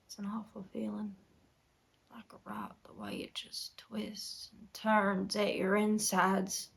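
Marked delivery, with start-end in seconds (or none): 0.1-2.8 barely voiced, whispery confession; hushed pain. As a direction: let it sink to a whisper.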